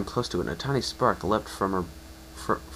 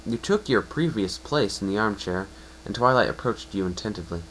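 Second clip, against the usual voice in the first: ordinary delivery, nothing marked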